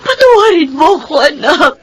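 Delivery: high, tearful begging; pleading mother in tears; crying plea. Acted. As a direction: beg through sobs for forgiveness. Mother begs forgiving son.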